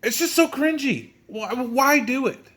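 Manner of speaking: high-pitched, pained disbelief; exasperated cringing. Real, not acted. Exasperated at half-hearted censoring.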